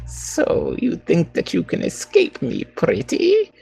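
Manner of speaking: high, sneering witch voice; hag voice. Acted. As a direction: taunt like a cruel old hag. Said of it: "Min's best, uh, hag voice".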